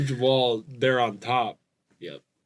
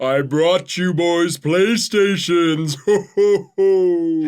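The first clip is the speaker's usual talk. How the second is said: slow, louder ghostly Santa; 3 semitones higher, 5 dB louder, slower than usual. Acted. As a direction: Santa voiced as a spirit. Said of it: "Santa always kinda sound like a ghost".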